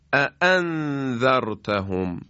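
both hamzas in اَأَنذَرْتَهُمْ struck sharp and forceful; sharp strong hamza. Shown as a demonstration. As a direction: snap out each glottal stop in اَأَنذَرْتَهُمْ. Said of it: "ما اینجا دو تا همزه داریم".

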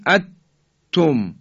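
"اتم" with the noon swallowed into a doubled ta; idgham. Shown as a demonstration. Teacher shows a wrong reading, full idgham. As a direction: drop the noon, stop on the ta in "اتم". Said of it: "اینم که دیگه حالت ادغامه".

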